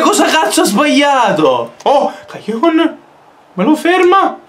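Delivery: ordinary delivery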